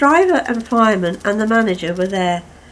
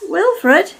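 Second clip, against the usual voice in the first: high, loud call; 10 semitones higher, 5 dB louder than usual. Acted. Caldy calls to Wilfred, high and loud. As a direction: call across the station. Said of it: "he called".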